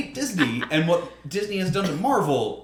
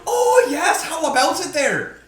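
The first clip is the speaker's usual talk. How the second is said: high, loud, drawn-out Midwestern greeting; hammed-up Midwest accent; 10 semitones higher, 5 dB louder, slower than usual. Host joins mocking Midwesterners, high Midwest accent. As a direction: swoop up into mock Midwestern. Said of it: "try to do the Midwest accent".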